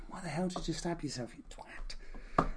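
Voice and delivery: high, hissy whisper to a dying lover. Pretend. Cleopatra whispering to dying Antony in her arms, scolding whisper. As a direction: scold him in an urgent, soft whisper. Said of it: "she's probably there whispering to him".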